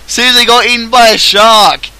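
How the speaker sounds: high, loud joke exclamation; mock shouting. Streamer adding another Australian joke, mock shouting. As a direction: blurt it out as a silly outburst. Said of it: "start shouting random Australian terms at you".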